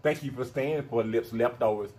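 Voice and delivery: ordinary delivery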